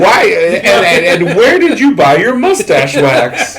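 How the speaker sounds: cracking up at the absurd question; laughing. Real, not acted. Laughing while mocking a cop.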